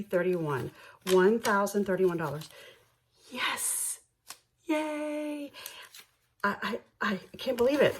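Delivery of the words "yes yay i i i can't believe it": much higher squeal of joy; excited cheer. Real, not acted. Hitting her savings goal, she cheers excitedly. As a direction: cheer like you've won. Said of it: "Sorry, I'm so excited".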